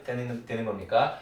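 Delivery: ordinary talk, nothing marked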